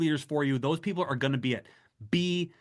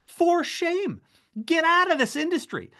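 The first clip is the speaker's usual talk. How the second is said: high-pitched mock scold, much higher; 14 semitones higher than usual. Speaker scolds passionless fundraisers, high mock scold. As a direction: wag a finger in a shrill put-on voice.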